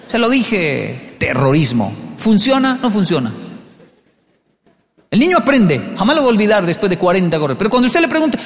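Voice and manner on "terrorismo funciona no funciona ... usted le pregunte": normal talking voice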